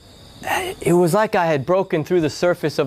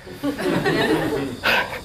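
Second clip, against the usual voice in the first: breathy unvoiced giggle, pitched up; airy high laugh; 12 semitones higher, 3 dB quieter than usual. Real, not acted. After his sister hangs up, an airy laugh.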